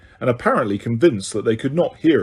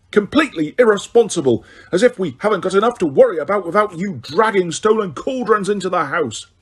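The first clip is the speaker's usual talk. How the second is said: angry scolding; 7 semitones higher than usual. A narrator's reading. Mrs Weasley shouting downstairs.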